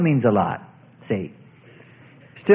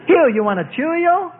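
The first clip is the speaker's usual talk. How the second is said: put-on baby talk; 10 semitones higher than usual. Offering a baby a Cheerio.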